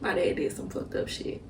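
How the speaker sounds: real held-back crying, barely voiced; tearful whisper. Real, not acted. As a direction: let it out in a broken whisper. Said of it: "I ain't gonna cry".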